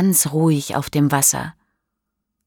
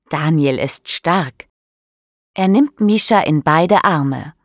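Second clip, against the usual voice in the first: ordinary delivery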